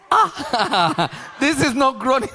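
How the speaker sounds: high, laughing protest. Real, not acted. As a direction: say it through helpless laughter. Laughing at video prayer he retells.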